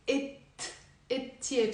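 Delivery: it ثياب with a held double T; L disappears. Shown as a demonstration. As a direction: stop on the T, then release into ثياب. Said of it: "So the l sound disappears".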